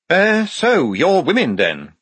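high, loud, dropping at the end; De Word, hesitant reporter. A narrator's reading. Notebook man questioning Polly, high and hesitant. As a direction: open awkwardly in a high voice.

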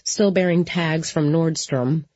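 ordinary delivery, nothing marked

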